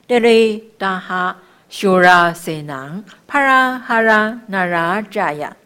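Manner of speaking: loud, measured syllable-by-syllable chant; sustained chanting of sacred syllables; mantra recitation. Shown as a demonstration. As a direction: recite it firmly, phrase by phrase.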